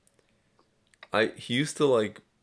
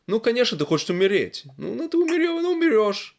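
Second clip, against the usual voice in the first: high-pitched sarcastic go-ahead in Russian; mock reverse psychology; 9 semitones higher, 4 dB louder than usual. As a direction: goad them with exaggerated, high-pitched consent. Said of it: "it's reverse psychology".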